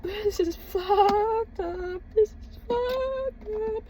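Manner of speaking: high and very slow, drawn out; slow, stretched disbelief. Real, not acted. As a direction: let each word hang in stunned disbelief.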